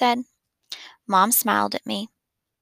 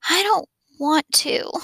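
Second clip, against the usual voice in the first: put-on babyish whine; 7 semitones higher than usual. Boy pleads with his parents in a babyish voice. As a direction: play it small and babyish to get sympathy. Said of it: "I made my voice sound a little babyish".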